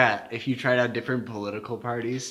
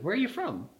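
higher-pitched mock question; curious customer; 9 semitones higher than usual. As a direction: imitate a customer asking, pitched up. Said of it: "He's like".

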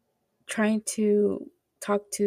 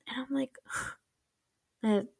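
sighing "man" in a breathless whisper; dreamy whisper; 4 semitones higher, 8 dB quieter than usual. Real, not acted. Fan swooning at the drama's confession, whispering. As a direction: melt over the line, whispered.

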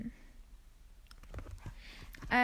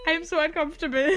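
awkward mock voice; 5 semitones higher, 7 dB louder than usual. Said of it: "und bin so".

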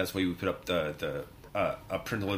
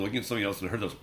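normal talking voice

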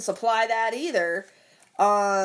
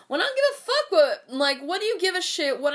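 outraged outburst; 8 semitones higher than usual. Real, not acted. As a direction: pitch jumps way up in sudden outrage.

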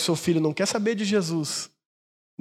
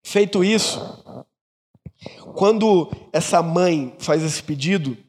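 normal talking voice

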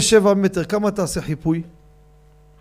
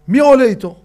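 higher, louder call; 6 semitones higher, 8 dB louder than usual. Retold or imitated. Someone asking who rides with the child, loud call. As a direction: call out across the crowd. Calling for a volunteer.

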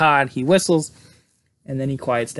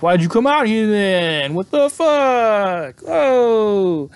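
loud, slow New Jersey mobster rant; Sopranos mobster voice; 9 semitones higher, 5 dB louder, much slower than usual. Retold or imitated. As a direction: bark it like a Jersey wiseguy. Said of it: "I gotta quit watching The Sopranos".

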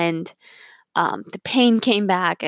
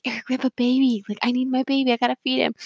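higher, pressing plea to her husband; urgent plea; 6 semitones higher, faster than usual. Retold or imitated. Wife waking her husband to feed the baby, urgently. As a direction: insist you need your baby now.

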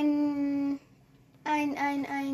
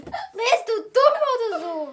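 high laughing jibe; 8 semitones higher, 5 dB louder than usual. Real, not acted. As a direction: laugh through the question. Laughing at the clip.

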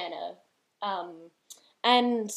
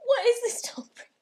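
laughing; 8 semitones higher, slower than usual. Real, not acted. Embarrassed laughing.

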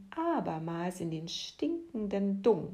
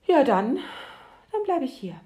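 higher, louder, firmly decided; with determination; 6 semitones higher, 5 dB louder than usual. A narrator's reading. Worm refusing the deva's heaven, firmly and loudly. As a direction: dig in your heels and refuse. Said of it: "erklärte der Wurm mit Bestimmtheit".

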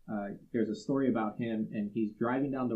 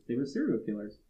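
ordinary delivery

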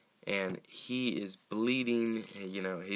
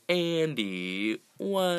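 a stunned, incredulous "Andy, what?"; baffled disbelief; 8 semitones higher, 5 dB louder, much slower than usual. Retold or imitated. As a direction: voice jumping up on "what" in shock. Finding Andy tied up, baffled.

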